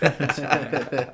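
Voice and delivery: laughing through the words. Real, not acted. Guest laughing at the host's G-footed story. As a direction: laugh it out, amused.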